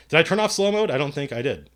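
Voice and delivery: ordinary talk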